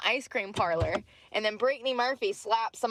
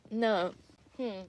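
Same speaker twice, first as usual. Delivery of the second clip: normal talking voice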